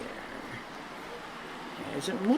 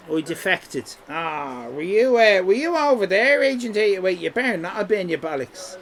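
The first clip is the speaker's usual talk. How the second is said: ordinary delivery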